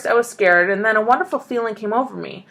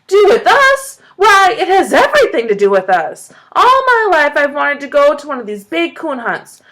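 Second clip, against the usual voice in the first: high, loud, excited near-shout; almost shouting; 6 semitones higher, 7 dB louder than usual. A narrator's reading. Grandpa telling Billy of his lifelong dream, near-shouting. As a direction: burst out with eager joy. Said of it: "and almost shouted".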